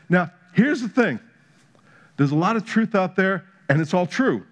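rising preacher's fire; preaching. Acted. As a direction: drive the point home from the pulpit. Preaching climax.

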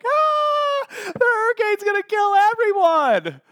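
high-pitched panicked scream, hammed-up; mock panic. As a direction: shriek it in comic hysteria. Acted panic in sermon.

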